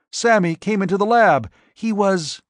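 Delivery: high panicked call; frightened cry. Acted. Marion calling Stephen in terror, high.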